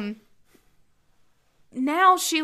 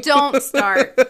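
loud warning; 7 dB louder than usual. Real, not acted. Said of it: "when I yelled at you just then".

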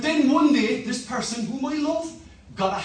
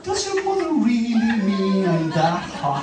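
talking iron. Pretend. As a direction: lure the child in, a hammed-up temptation. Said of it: "the iron began to say to me".

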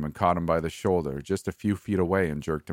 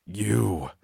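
rasped; 3 semitones higher than usual. A narrator's reading. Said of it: "Brian rasped".

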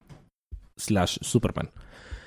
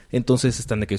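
usual speaking voice

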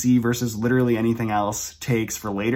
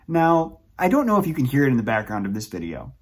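ordinary delivery

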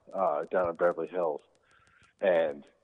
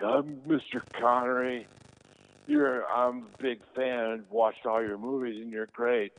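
high-pitched imitation of an overawed fan; retold fan worship, voice lifted; starstruck gushing; 6 semitones higher than usual. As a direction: gush at your idol, drawn out at first.

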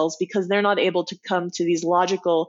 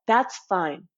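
normal talking voice

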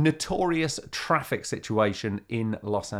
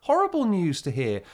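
mock dismay; 11 semitones higher than usual. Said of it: "And I'm like".

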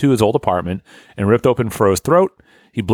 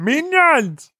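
much higher, squeaky cartoon minion. Joking about minions, in a high squeaky cartoon voice.

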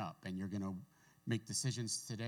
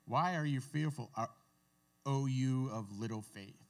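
Jesus rebuking; 4 semitones higher, 5 dB louder than usual. Retold or imitated. Jesus rebukes fearful disciples.

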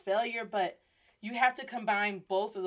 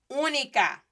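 "Única" with OO higher and louder; emphasized first syllable; 4 semitones higher, 5 dB louder than usual. Shown as a demonstration. Teacher saying única for a chica, stressed first syllable. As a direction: punch the opening of "Única". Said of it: "I emphasize it more".

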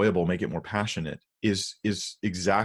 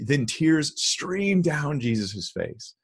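drawn out, higher, savoring "streamed"; mock dramatic; 6 semitones higher, much slower than usual. Shown as a demonstration. Pastor mocking the Passion Translation, slow and dramatic. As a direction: ham it up like the flowery version. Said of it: "It's not passionate enough".

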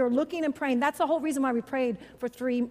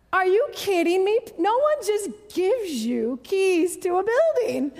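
high, loud, slow incredulous retort; loud disbelief; 5 semitones higher, 5 dB louder, slower than usual. Retold or imitated. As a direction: push back in disbelief. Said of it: "I literally said".

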